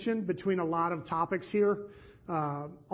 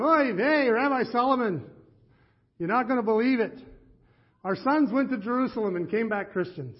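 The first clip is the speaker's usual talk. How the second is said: put-on a higher, louder Yiddish-accented lament to the rabbi; 5 semitones higher, 4 dB louder than usual. Two fathers complain to Rabbi Solomon in a Yiddish accent. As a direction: wail "Oy vey" with a Yiddish lilt. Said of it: "they begged me not to do the accent".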